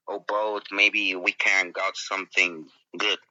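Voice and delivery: ordinary talk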